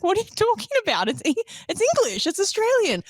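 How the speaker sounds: high, fast broad Australian dad protest; mock an indignant broad Australian dad; ocker Australian dad. Daughter imitating her broad Australian dad's protest. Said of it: "he goes full, like, he's so ocker".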